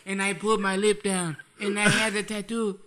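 keeps a loose mock Wakandan accent; light mock Wakandan accent, laughing. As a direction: defend himself in a playful Wakandan accent. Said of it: "in Wakandan accent".

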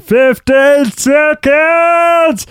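high, slow coach shout; gym instructor impression. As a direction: bellow it like a trainer. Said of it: "Just like".

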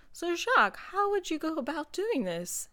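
high questioning host voice; lightly voiced host asking; quoting Nicole. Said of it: "Nicole will be like".

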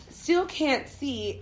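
normal talking voice

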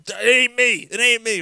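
high, loud mock protest; alarmed denial. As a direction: protest innocence, imitating alarm. [name] hastily denies drawing the heart.

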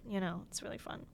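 ordinary talk, nothing marked

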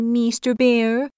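timid little creature voice; polite hedgehog address. Acted. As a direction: address the bear meekly. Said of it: "Please be pardoning me".

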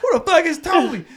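high, loud angry yell; furious shout. Retold or imitated. Yelling at wife about mysterious Toby, furious shout. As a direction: demand it at full volume. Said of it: "And I'm like".

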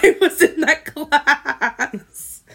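laughing through the words; words dissolving into a laugh; real laughter. Real, not acted. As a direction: break up laughing while admitting it. Laughing at her own forgetfulness.

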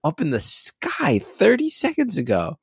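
high, wondering excited voice; mock amazement. Dad marvelling at birds, mock amazement. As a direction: share the child's wonder. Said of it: "I'm like".

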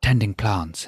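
a lightly voiced prim British gent, mild; hint of a stiff upper-lip Englishman; mock posh Brit. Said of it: "I found it all very British".

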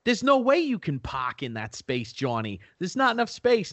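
put-on thick Boston accent. Pals doubt Johnny can park, thick Boston accent. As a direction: scoff in broad Bostonian. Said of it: "like, a thick Boston accent".

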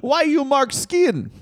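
hammed-up Russian voice; mock Russian accent. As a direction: demand it like a cartoon heel. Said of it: "both good at accents".

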